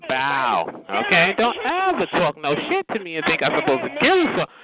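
ordinary delivery

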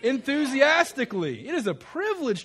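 loud, high, rousing shout-out of the word; enthusiastic. Shown as a demonstration. Preacher showing the congregation an improved answer, enthusiastic. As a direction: belt it out with real zest. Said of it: "So let's improve on that".

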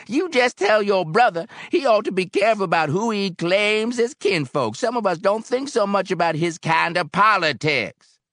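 high, loud, angry warning; hostile McAdams. A narrator's reading. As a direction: warn them off loudly, pitched high.

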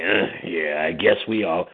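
low, sulky mumble; grudging reply. Retold or imitated. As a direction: concede it through sulking. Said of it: "I'm like".

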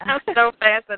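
high, bright gushing delight; excited. Real, not acted. Interviewer delighted by the guest's story, gushing. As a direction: gush with real fascination.

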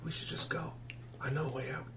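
hushed, near-whispered plea; soft secretive boy; furtive runaway boy. Acted. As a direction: keep it very quiet, barely voiced.

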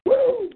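high wrestling-promo woo, yelped out; Ric Flair woo. Retold or imitated. Host imitating Ric Flair in a wrestling promo, piercing high woo.

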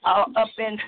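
measured rhythmic poem recital with dramatic pauses; spoken word poem. Acted. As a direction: perform it like a slam poem, savouring each line. Spoken-word love poem performance.

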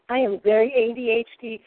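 normal talking voice

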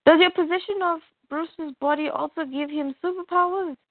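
a hint of a sly female villain asking; voiced for the plant villain questioning; lightly voiced Poison Ivy.

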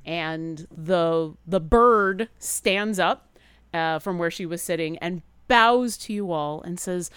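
normal talking voice

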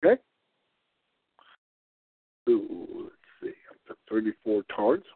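ordinary delivery

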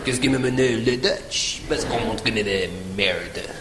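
low, grumbling mock French; drawn-out comic French muttering; French accent. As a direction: put on deep, slow French. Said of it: "The French looked around and went".

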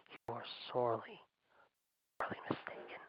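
dropping to a hushed threat; ominous whispered warning; menacing whisper. Real, not acted. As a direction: lean in and breathe the warning.

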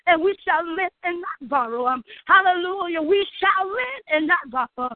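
normal talking voice

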